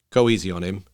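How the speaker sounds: ordinary delivery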